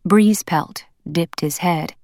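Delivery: plain reading voice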